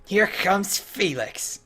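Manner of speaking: high-pitched jubilant gnome voice; triumphant gnome character. Acted. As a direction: crow it like an excited little adventurer. Voices a game gnome in quest dialogue.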